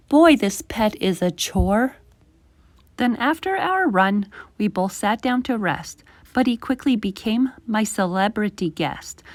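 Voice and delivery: ordinary delivery, nothing marked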